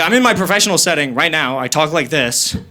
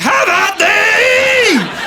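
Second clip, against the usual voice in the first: mock battle cry; 4 dB louder, much slower than usual. Jouster charging on a moped.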